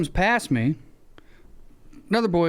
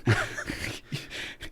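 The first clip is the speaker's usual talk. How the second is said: breathless laugh; 9 dB quieter than usual. Real, not acted. Laughing while telling.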